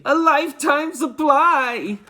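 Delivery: slow, high, envious drawl; grudging envy; jealous boy marvelling. A narrator's reading. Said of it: "another boy said enviously".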